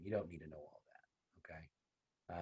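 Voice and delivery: hushed, reassuring whisper to the class; whispered aside. Real, not acted. Teacher whispering to students that they can skip this. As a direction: drop to a whisper as if letting them off the hook.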